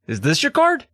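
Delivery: high rising question; high pitched question. Retold or imitated. Repeated card trick guesses.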